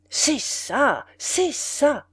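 swelling with delight; delighted exclamation. Acted. Café owner approving the prince's words, delighted exclamation. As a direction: exclaim in rapturous approval, voice placed apart from the narration. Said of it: "s'écria-t-il, dilaté".

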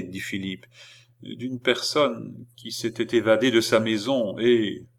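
usual speaking voice

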